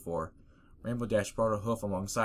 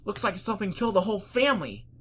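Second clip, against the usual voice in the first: tomboy pony voice; 12 semitones higher, 5 dB louder than usual. Acted. Rainbow voiced.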